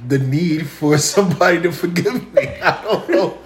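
laughing, tipsy rambling. Real, not acted. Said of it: "I'm a little tipsy".

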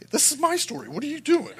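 high, baffled mock protest; incredulous. Preacher imitating his own reaction to the weeping couple, high incredulous protest. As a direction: squeak it out in disbelief.

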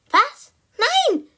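high, loud shriek of protest; shrieked refusal; horrified scream. Acted. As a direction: cry out in shock, very high.

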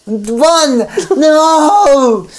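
high, slow hammed-up wail; over-the-top dramatic shout; anguished cry. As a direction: cry out in mock despair. Jonathan cries out for Dracula.

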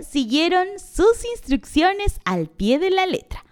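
usual speaking voice

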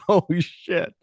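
high, startled reaction; shocked. Retold or imitated. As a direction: gasp it out in shock. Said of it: "you're like".

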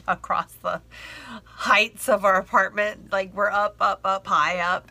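ordinary delivery, nothing marked

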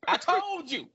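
high-pitched, triumphant bragging; victory-lap gloat. Real, not acted. Taking a victory lap over Callum, gloating. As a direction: crow it high, rubbing it in. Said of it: "Get my little victory lap".

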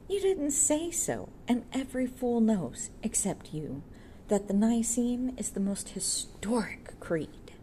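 high, slow, scornful comeback; scornful retort. A narrator's reading. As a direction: put the know-all in his place.

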